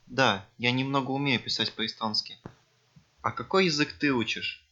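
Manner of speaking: ordinary delivery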